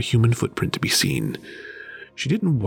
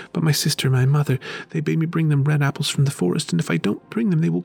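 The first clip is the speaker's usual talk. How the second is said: put-on a girl's sad, higher plea; 7 semitones higher than usual. A narrator's reading. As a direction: plead with father January in sorrow. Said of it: "Said Marusha sadly".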